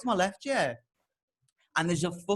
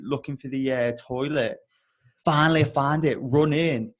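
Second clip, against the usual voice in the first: ordinary delivery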